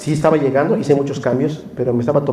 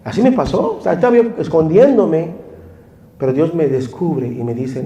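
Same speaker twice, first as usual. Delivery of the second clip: ordinary talk, nothing marked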